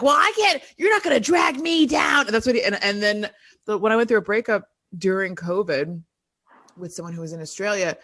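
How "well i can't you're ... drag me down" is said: high, exasperated dad impression; mock dad. Father refusing to be dragged down, exasperated mock. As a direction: throw up your hands as her father. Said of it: "and then he'll be like".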